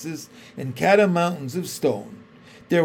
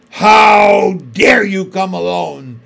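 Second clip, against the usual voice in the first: slow, much louder furious witch; terrible voice; 4 semitones higher, 12 dB louder, slower than usual. A narrator's reading. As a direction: roar it down at the boy. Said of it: "said the witch in a terrible voice".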